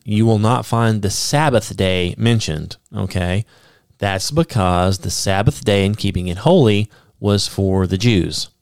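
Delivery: normal talking voice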